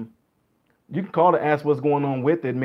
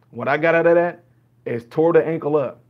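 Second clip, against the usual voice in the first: ordinary talk, nothing marked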